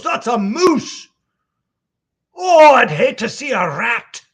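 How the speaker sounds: high, loud Scottish outcry; astonished Scotsman. Acted. Old Scotsman answering the conductor about the moose, high astonished Scottish shout. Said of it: "He goes".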